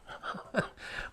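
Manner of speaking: quiet breathy chuckle; soft unvoiced chuckle; soft laugh. Real, not acted. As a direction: let a small laugh slip. Teller laughs at memory.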